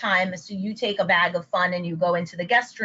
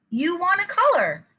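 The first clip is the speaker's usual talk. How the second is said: much higher, full-voiced echo to a child; parent's reflection; 10 semitones higher than usual. Shown as a demonstration. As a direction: repeat it back brightly, falling at the end. Parent echoes soft-spoken child.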